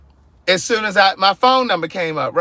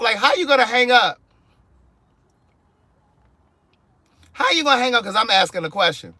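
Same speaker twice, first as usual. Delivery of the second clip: indignant; 5 semitones higher than usual. Real, not acted. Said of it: "I was so pissed off".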